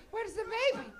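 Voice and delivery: high, lightly voiced old lady asking; old Italian lady. Old Italian lady on the subway looking for the baby, high-pitched. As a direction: peer around like a puzzled grandma. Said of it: "I could see her going".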